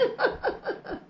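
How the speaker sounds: short high laugh. Real, not acted. Wife laughing at her own joke, short high laugh.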